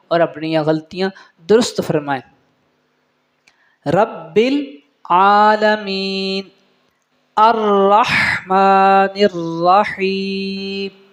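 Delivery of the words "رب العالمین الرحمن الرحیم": slow, chanted tajweed with long held vowels; Quranic recitation. Shown as a demonstration. Teacher recites for students, slow melodic Quranic chant. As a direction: intone each phrase, sustaining the endings.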